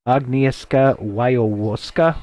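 slow attempt at a foreign name; careful, halting name reading; uncertain pronunciation. Real, not acted. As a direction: pick through Agnieszka Wojewowska piece by piece. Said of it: "my poor pronunciations".

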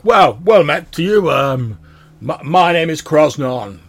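bellowing dwarf. Acted. Dwarf introducing himself to the watch, bellowing. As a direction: boom out a hearty greeting. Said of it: "he's like".

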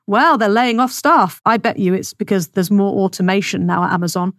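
normal talking voice

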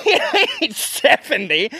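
high, slow, laughing repeat; giggling repeat of the punchline. Real, not acted. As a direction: laugh the words out. Repeats line while laughing.

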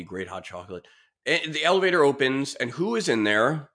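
ordinary talk, nothing marked

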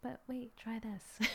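soft, near-whispered nudge; inner whisper. Retold or imitated. Inner voice nudging her to try something, whispered. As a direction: breathe it like a quiet inner voice. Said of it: "a little bit more of, like, a, a whisper".

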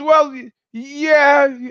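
high, drawn-out reluctant answer; acting out someone unsure; imitated hesitation. Surfer's reluctant answer, retold.

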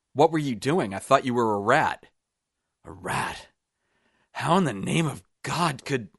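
high, agitated outburst; panicked disbelief. A narrator's reading. Roop confronts the man he shot by mistake, panicked. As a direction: blurt it in horrified disbelief.

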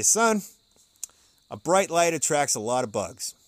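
"Son" called out high and loud, then a fatherly saying; lightly voiced preacher father giving advice; dad advising his son, lightly imitated; imitating preacher dad. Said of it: "But he would say".

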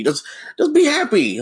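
very high, mock-cheerful urging; mocking chirpy manager. Worker mimicking managers telling him to be happier, mocking chirpy cheer. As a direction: imitate a boss demanding instant happiness. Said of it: "how they word it is like".

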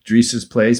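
ordinary talk, nothing marked

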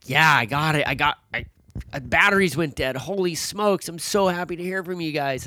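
voicing a thrilled, relieved friend; relieved excitement. Boat driver to the lost hikers on the radio, relieved.

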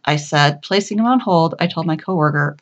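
plain reading voice

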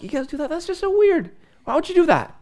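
high, mock-incredulous friend voice. Friend reacting to a weird family custom, mock-incredulous high voice. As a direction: pitch it up like a friend who can't believe it.

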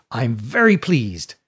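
grinning, slow delighted announcement; proud schoolmaster savouring his words; very pleased rector. A narrator's reading. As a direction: beam it out to the class, drawn out. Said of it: "said the rector, grinning at the class".

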